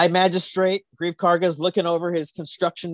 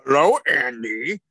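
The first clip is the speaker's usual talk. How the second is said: seaweed impression; slower than usual. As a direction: drag out a goofy seaweed greeting. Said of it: "That's my impression of, uh, seaweed".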